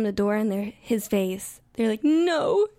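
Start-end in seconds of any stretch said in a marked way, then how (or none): 2.0-2.7 high, loud refusal; retold defiant shout. Models refusing the photographer at the door, retold shout. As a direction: shout a mock-outraged no, imitating.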